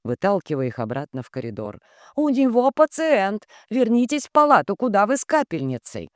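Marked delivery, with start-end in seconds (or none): 2.2-6.1 indignant. A narrator's reading. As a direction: scold them back to the ward. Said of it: "возмутилась".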